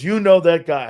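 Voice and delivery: mock the wife's disbelief; retelling the wife's amazed question; incredulous. Wife incredulous, retold.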